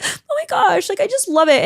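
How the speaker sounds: high, choked-up gush of love; teary gush. Retold or imitated. Moved camper gushing over the girls, teary. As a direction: voice catching with happy tears.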